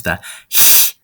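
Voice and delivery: a harsh throaty hiss; throaty ch-sound demo; angry badger hiss. Shown as a demonstration. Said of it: "that angry badger noise".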